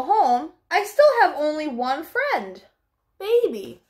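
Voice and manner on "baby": ordinary delivery, nothing marked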